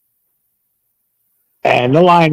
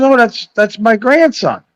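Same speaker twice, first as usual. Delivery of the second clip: higher mock voice of a sister asking for money; mimicking sister; 11 semitones higher than usual. Teller mimics half sister begging for cash, higher mock voice. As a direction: ham up the sister's sweet ask. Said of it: "She goes".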